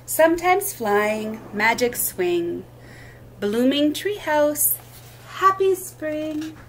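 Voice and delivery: sing-song picture-book reading, lilting rhythm; reading to children. Acted. Teacher reading rhyming book aloud at storytime, lilting. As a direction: read each line like a gentle rhyme for little ones.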